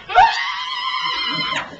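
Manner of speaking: long, high, loud acted scream; terrified scream. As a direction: scream as if seeing a ghost. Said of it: "As if I saw a ghost".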